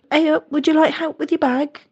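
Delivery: imitating a helpful stranger in a dodgy northern accent; terrible northern accent. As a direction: put on a bad northern English accent. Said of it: "apologies, that was a terrible accent".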